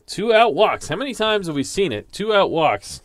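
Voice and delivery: high, loud exasperation on the repeated phrase; exasperated commentary. Real, not acted. Commentator lamenting another walk with two outs, exasperated. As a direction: exclaim in exasperation, pitched well up.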